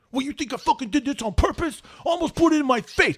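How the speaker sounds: high, rising outrage, speeding up; mock furious rant at the manager; hammed-up indignant shouting at the boss; indignant yell. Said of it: "I was like".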